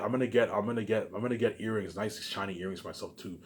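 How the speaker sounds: ordinary delivery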